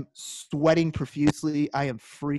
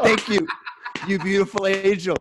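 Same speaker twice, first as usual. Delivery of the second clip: laughing while gushing thanks, a bit higher and louder; laughing gratitude; 4 semitones higher, 4 dB louder than usual. Retold or imitated. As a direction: gush thanks through laughter. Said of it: "And I was just like".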